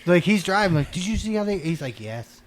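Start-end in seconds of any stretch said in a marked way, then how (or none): 0.1-1.6 high-pitched, eager questions; excited retelling. Retold or imitated. Hunter to companion driving home after the hunt, excited. 2.0-2.4 subdued mock reply. Driver answering an eager passenger, subdued. Companion's reply to excited questions.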